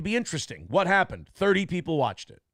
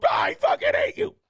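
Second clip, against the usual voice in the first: a hammed-up high shout of hatred; mock hate shout. Imitating an angry fan yelling at the host. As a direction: yell it like a furious fan typing. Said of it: "Don't just get in there and go".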